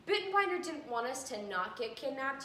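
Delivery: ordinary talk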